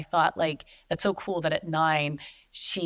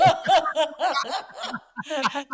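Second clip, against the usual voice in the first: laughing; 10 semitones higher, 4 dB louder than usual. Real, not acted. Laughing at own accent.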